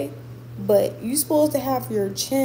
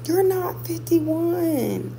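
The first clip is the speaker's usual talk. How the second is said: higher, very slow "You're not fifty-one" stretched in disbelief; drawn-out disbelief; 9 semitones higher, much slower than usual. Real, not acted. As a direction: stretch every word out like you can't believe it. Disbelief at viewer's age.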